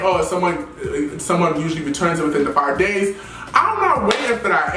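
ordinary talk

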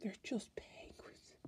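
slow hushed whisper. Real, not acted. Showing viewers the penguin card, slow whisper. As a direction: breathe it out slowly, awed.

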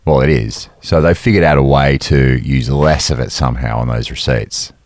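ordinary talk, nothing marked

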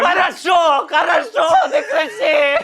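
real yell, climbing in pitch at the end; exasperated shouting. Real, not acted. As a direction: shout over your partner to make them stop. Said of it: "Чого він кричить".

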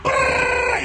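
loud, high ooh and brrr; excited stalling. Retold or imitated. Young speaker about to spill the story, loud high. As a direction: wind up before spilling it.

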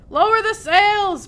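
high, loud, drawn-out command; urgent captain's shout; shouted order. A narrator's reading. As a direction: holler it across a stormy deck. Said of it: "Braidbeard shouted".

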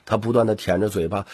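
usual speaking voice

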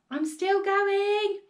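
high, loud, drawn-out screech; screechy old voice. A narrator's reading. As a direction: screech it down from up above. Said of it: "came the old screechy voice from up above".

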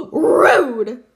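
big gasp, then a loud exclamation; hammed-up shocked indignation; mock offended. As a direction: gasp and blurt it in playful outrage. Pretend offence at jammer's L.

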